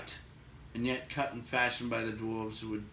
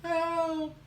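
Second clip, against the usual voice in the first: high thin cry of "Help!"; high distant plea; faint cry; 3 dB louder than usual. Acted. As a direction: cry out small and far away. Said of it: "heard a small cry".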